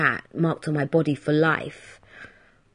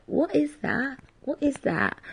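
put-on higher, softer kid asking; lightly voiced curious child; little kid voice; 7 semitones higher, 8 dB quieter than usual. Little kid pointing at MP3 player, curious. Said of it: "little kids say to me".

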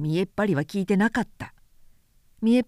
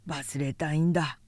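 lower, softer sad mumble; glum drunkard; 4 semitones lower, 6 dB quieter than usual. A narrator's reading. Drunkard confessing to the prince, lower soft glum voice. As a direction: sink into gloom.

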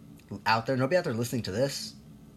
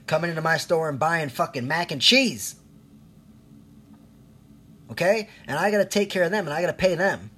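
rising into a loud, high-pitched rant; exasperated yelling, louder and higher with each line; angry rant; 7 semitones higher, 7 dB louder than usual. Real, not acted. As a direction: let it boil over and shout at them. Ranting at stay-at-home moms.

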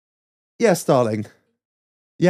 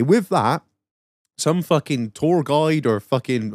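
usual speaking voice

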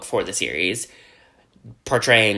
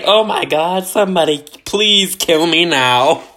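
mock despair; 6 semitones higher, 6 dB louder than usual. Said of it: "She's just like".

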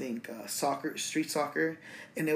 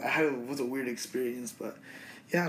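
usual speaking voice

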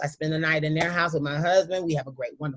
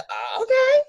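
high, squeaky unsure "uh, okay"; awkward surprise; 4 dB louder, slower than usual. Retold or imitated. To her father asking for a photo, a high startled okay. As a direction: caught off guard, voice jumping way up. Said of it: "And I was like".

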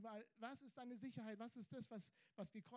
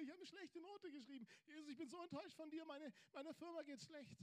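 higher, sulky lament; whining believer; 7 semitones higher than usual. Retold or imitated. Believer whining to Jesus about his troubles. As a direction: list the woes in a raised whine. Preacher mimics complaining believer.

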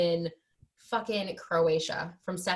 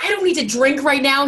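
much louder and higher; mock indignant snap; 7 semitones higher, 10 dB louder than usual. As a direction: snap back offended. Retold snapping at ex.

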